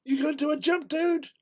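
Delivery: lightly mocking an American skydiver; chummy American dude. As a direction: ask it like a friendly hyped Yank. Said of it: "they turn to me and go".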